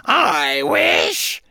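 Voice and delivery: a duck shrieking in greed. Acted. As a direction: let it fly as a shriek. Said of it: "shrieked the duck".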